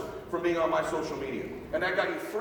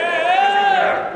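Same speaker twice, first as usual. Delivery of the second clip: much higher and louder, a burst on "doesn't"; frustrated outburst; 12 semitones higher, 11 dB louder than usual. Real, not acted. Speaker giving up on the broken clicker, an exasperated loud outburst. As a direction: blurt it out in exasperation.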